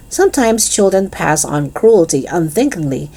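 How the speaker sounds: ordinary delivery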